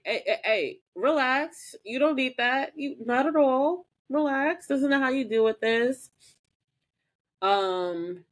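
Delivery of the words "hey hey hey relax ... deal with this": higher, mock calming; soothing Prue. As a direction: talk her down. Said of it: "Prue's just like".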